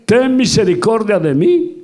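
high, loud pleading cry to Jesus; desperate shout. Retold or imitated. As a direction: cry out for mercy at the top of the voice. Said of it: "y empezó a gritar".

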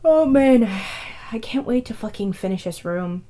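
usual speaking voice

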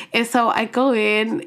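ordinary talk, nothing marked

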